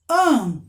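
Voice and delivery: isolated "an" with a nasal vowel; nasal sound. Shown as a demonstration. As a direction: let the N in "an" ring through the nose. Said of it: "dá o som nasal".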